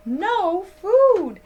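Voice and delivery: ordinary delivery, nothing marked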